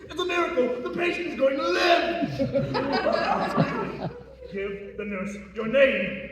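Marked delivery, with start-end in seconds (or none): 0.1-2.3 high, loud, laughing shout; excited laughing shout. Acted. Doctor celebrates the patient's survival. 2.3-4.5 high, breathy, gleeful cackle; gleeful laugh. Acted. Doctor laughs after the news.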